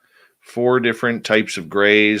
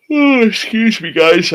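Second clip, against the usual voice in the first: higher and louder, words stretched by a yawn; 9 dB louder than usual. Real, not acted. Streamer yawning mid-apology to chat, real yawn. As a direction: talk right through a big yawn. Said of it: "I apologize for the yawning".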